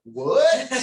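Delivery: sudden high loud what; shocked what. Retold or imitated. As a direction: blurt it out in shock. Nurses reacting in shock.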